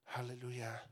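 ordinary delivery

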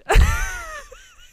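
a high shrieking burst of laughter. Real, not acted. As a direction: let out a loud squealing laugh.

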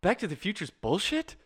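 high-pitched mock disbelief; incredulous. Podcaster quoting a film line to his co-host, incredulous. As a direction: imitate someone crestfallen and incredulous, pitched right up.

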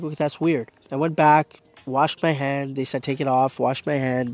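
ordinary delivery

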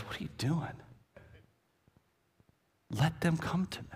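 hushed, sharp rebuke; harsh Jesus. Acted. Jesus rebuking disciples in a hushed sharp tone. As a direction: scold the disciples under your breath. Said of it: "He's harsh".

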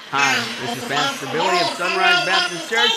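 hammed-up distorted preacher voice with laughter; bad sound demo. Shown as a demonstration. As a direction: sound like a mic with no sound engineer. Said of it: "then I would sound like this".

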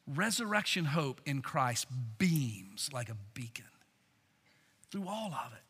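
soft, breathy whispered hope; a preacher hushing the crowd to whisper of hope; hushed whisper. Acted. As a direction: whisper it like a secret of comfort. Sermon image of hope among tears.

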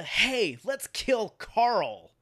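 sarcastic imitation of an overeager writer; mock bright idea. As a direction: ham up a smug boss pitching a terrible idea. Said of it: "Gimple has this bright idea".

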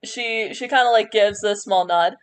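usual speaking voice

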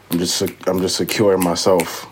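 usual speaking voice

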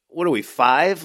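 normal talking voice

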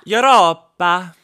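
Europa in a slow, loud Greek accent. Shown as a demonstration. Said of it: "I said that with a Greek accent".

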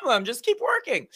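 very high, quick, falsely casual reassurance; falsetto fake breeziness. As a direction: sound carefree while hiding you're broke. Faking confidence about money.

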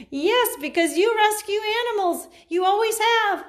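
high squeaky cat voice; kitten voice. Pretend. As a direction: answer as the little kitten. Cat voiced answering her.

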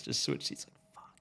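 soft unvoiced urgent plea; whispered plea. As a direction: whisper it so the guard can't hear.

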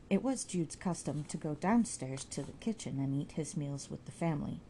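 usual speaking voice

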